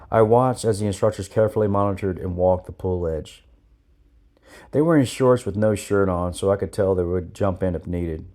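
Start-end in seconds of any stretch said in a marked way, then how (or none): none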